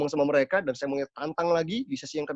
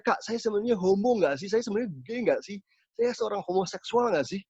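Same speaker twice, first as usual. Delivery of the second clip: baffled question; 6 semitones higher than usual. Retold or imitated. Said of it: "Dia tanya sama saya".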